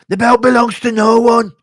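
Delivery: high, full shout in impression; Leon Edwards screaming. Leon Edwards screaming after his knockout, high shouted impression. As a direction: yell it out in punchy bursts. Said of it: "He changed it up when he was screaming".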